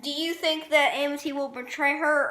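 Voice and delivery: ordinary talk, nothing marked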